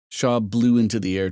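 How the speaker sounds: usual speaking voice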